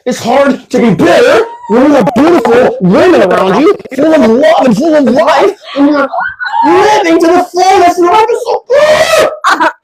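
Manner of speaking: high, loud and laughing; ecstatic shriek. Real, not acted. Spinning in circles, shrieking to the viewers.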